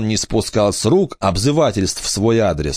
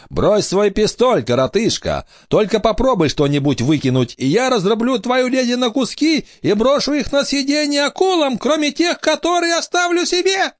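high, squeaky villain threatening; squeaky pirate captain. Acted. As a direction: menace them in a shrill, helium-high voice. Pirate captain threatens, then laughs shrilly.

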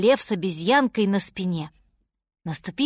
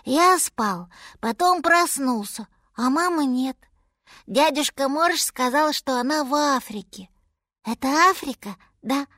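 put-on higher, childlike mammoth voice; little mammoth; 4 semitones higher than usual. A narrator's reading. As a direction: speak as a small, lost baby animal. Little mammoth tells his story.

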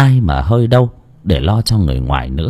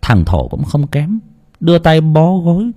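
usual speaking voice

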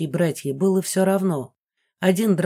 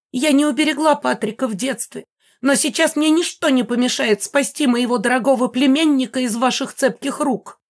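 high, loud indignant screeching; shrill old ghost on the warpath; 7 semitones higher, 4 dB louder than usual. A narrator's reading. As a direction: screech a defiant vow in a high voice. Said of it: "верещала призрак".

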